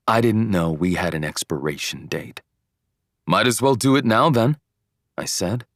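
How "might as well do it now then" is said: higher, louder impulsive retort; mad. A narrator's reading. Boyfriend blurts out breakup to girlfriend, mad. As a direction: blurt it out, nettled. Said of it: "I was mad and it just came out".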